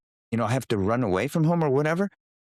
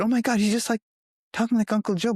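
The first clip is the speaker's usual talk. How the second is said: amazed exclamation; 10 semitones higher, faster than usual. Retold or imitated. Relatives exclaiming at a child.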